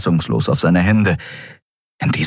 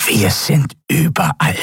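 pressed, nasal croak; 8 semitones higher than usual. A narrator's reading. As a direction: croak it through a squeezed throat. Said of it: "krächzte die gepresste, nasale Stimme". (gepresst und nasal krächzend)

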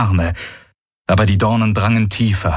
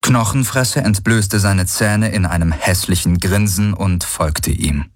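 usual speaking voice

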